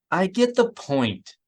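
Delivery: a bored interrupting brush-off; dismissive. A narrator's reading. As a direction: brush her off. Miranda cutting off Isard's threat.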